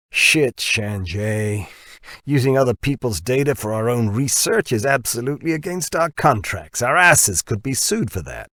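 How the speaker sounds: a brash boss balking at the risk; Dirk's voice. Acted. Dirk protests about the contracts.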